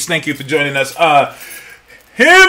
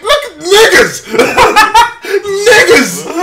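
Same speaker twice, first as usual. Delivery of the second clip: 1 slow laughing reply; 8 semitones higher, much slower than usual. Retold or imitated. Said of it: "I'm like".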